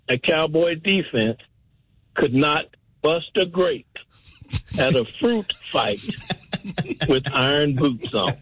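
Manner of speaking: slow, spaced out with long pauses; deliberately slow for comic punchline; real slow. Real, not acted. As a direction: deliver it piece by piece, slowly. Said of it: "say it real slow".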